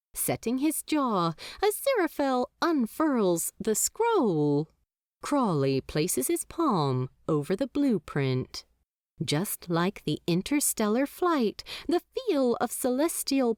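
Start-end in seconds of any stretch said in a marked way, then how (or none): none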